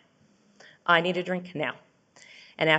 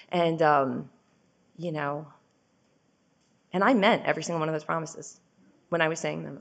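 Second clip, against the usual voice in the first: ordinary talk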